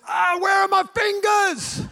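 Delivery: high, loud laughing mock shriek; screaming. Son pranks the ambulance crew with a loud mock scream. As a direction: shriek it like a panicked kid playing a prank. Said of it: "[name] screams".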